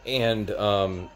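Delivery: ordinary delivery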